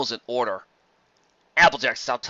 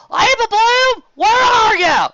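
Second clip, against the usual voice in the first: frantic calling out; 13 dB louder, slower than usual. Acted. Applejack searching, calling for sister.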